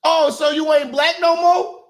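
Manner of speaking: mocking shout. Real, not acted. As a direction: yell it at her in disbelief. Mocking Harris's lost accent.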